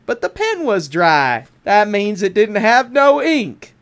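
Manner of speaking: ordinary delivery, nothing marked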